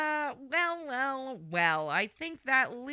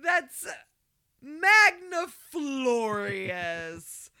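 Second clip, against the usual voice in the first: mock grandiose; 4 semitones higher, 6 dB louder, much slower than usual.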